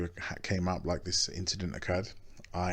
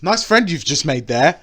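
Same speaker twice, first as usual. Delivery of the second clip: put-on sarcastic girlfriend; 8 semitones higher, 12 dB louder than usual. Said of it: "her immediate response was".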